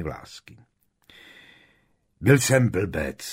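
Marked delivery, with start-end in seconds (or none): 2.2-3.2 louder, slow roar; a slow loud outburst. Acted. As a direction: bellow the confession. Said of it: "zařval najednou Percy".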